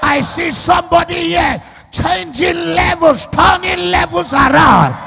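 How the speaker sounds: slow, ringing declaration with stretched words; full-force preaching. Real, not acted. Preacher making the congregation declare it, full-force preaching. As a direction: proclaim it for the crowd to repeat.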